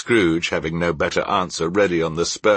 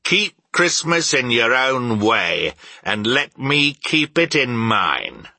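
slow, raised, stern command; 7 semitones higher, 3 dB louder, slower than usual. A narrator's reading. Uncle telling his nephew to leave him be, stern. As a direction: lay it down firmly and slowly. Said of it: "returned the uncle sternly".

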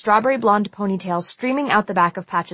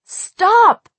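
high, loud urgent shout; 14 semitones higher, 8 dB louder than usual. A narrator's reading. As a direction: shout to break up a fight. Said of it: "I shouted".

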